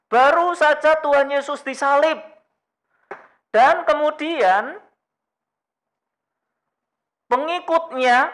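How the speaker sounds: high, loud impassioned preaching; full-force preaching. Acted. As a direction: preach it out with urgency. Passionate sermon build.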